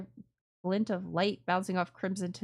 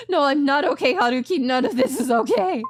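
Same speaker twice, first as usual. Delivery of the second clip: more desperate; 5 semitones higher, 7 dB louder than usual. Shown as a demonstration. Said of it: "same lines read again".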